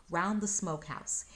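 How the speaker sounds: ordinary delivery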